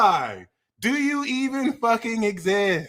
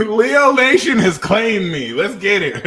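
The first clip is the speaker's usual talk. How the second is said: laughing excitedly; 7 dB louder than usual. Real, not acted. Celebrating that Leo Nation claimed her, laughing.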